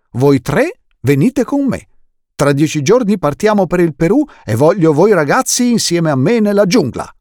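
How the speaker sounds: booming explorer uncle, delighted; enthusiastic uncle. Acted. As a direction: sweep them into the adventure. Uncle Monty invites children.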